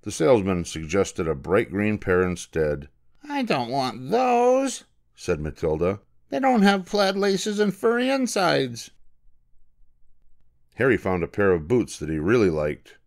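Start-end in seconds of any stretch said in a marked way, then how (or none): 3.2-8.9 put-on sulky girl. Matilda refusing boots.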